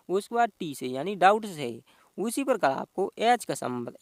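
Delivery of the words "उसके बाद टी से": normal talking voice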